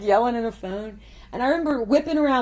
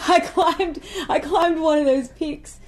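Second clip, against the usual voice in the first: laughing; 6 semitones higher, 4 dB louder, slower than usual. Real, not acted. Laughing while recalling climb.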